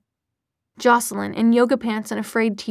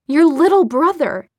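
louder, higher, pointed reproach; heated accusation; 5 semitones higher, 7 dB louder than usual. A narrator's reading. Clary trying to break through to Jace, accusing. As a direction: drive the accusation home, voice raised.

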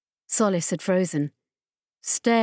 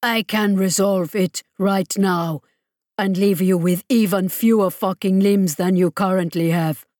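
menacing villain; 3 dB louder than usual. Acted. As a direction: threaten them with cold relish. Mesmond threatens the crew.

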